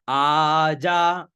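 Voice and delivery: आजा stretched very long as aaa-jaa; syllable demo. Shown as a demonstration. Teacher introducing आजा to students, very slow long vowels. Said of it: "आ और जा तो आजा".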